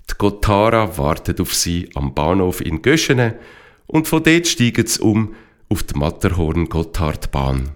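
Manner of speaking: usual speaking voice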